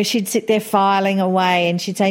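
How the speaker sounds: ordinary talk, nothing marked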